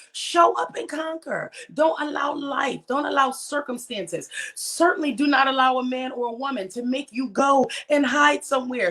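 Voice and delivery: rally them like a preacher, voice rising; preaching. Real, not acted. Host urging viewers not to hide, impassioned preaching.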